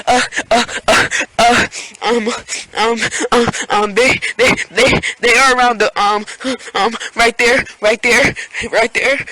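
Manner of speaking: high, gasping repeated syllables; mock a panicked, stuck stammer; bad stuttering. Friend gives cops his address, stuttering badly. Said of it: "he was stuttering, like, badly".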